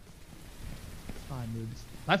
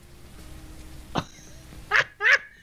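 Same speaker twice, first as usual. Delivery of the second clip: giddy laugh. Real, not acted. Discovers piglets.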